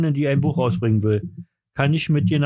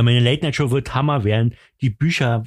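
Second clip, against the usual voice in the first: normal talking voice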